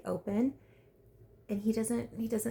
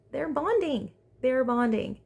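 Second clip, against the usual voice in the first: higher, louder gushing; excited repetition; 5 semitones higher, 5 dB louder than usual. Real, not acted. Vlogger gushing over the couple bonding in bed.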